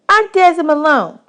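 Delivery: put-on little girl voice. Desi quizzing new boys about dishes, high bossy girl voice. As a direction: boss the new boys around.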